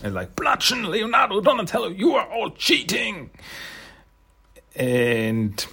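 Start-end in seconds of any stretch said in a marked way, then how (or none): 0.3-3.3 high-pitched mock shouting from another room. Vlogger voicing Koya shouting at the cheaters. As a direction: yell the accusation in a high voice. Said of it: "shouting from the other room".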